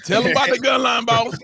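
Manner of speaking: high, loud cheering call; hype shout. Real, not acted. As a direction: egg him on like a hype man. Hyping up the co-host.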